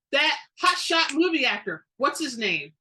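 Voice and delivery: bubbling over about the movie star; excited question. A narrator's reading. Sandra to Evan about the actor, excitedly. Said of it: "Sandra asked excitedly".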